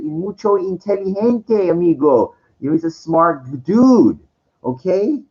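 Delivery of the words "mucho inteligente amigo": put-on Spanish accent. Switches to Spanish phrase.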